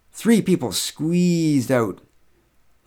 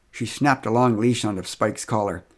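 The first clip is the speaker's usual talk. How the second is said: plain reading voice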